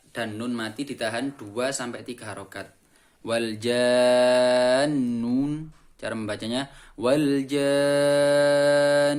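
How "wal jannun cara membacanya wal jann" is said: very slow "wal jann", the "ja" drawn out long and the nun held; long six-count madd. Shown as a demonstration. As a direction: stretch "jann" far out, then hold the nun. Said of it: "janya dibaca enam harokat". ("jann" dibaca panjang enam harakat lalu dengung ditahan)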